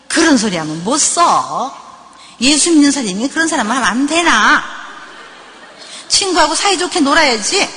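mock preachy telling-off with swooping pitch; scolding parent. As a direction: lecture the child with heavy emphasis. Acting the parent's wrong reply.

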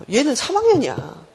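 normal talking voice

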